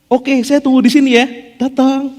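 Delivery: a hint of God keeping his distance; lightly voiced God.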